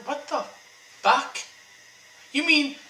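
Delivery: sudden high, loud "what the fuck"; outraged disbelief. Real, not acted. Ranting viewer learns Michelle Pfeiffer played the Wasp, shocked outrage.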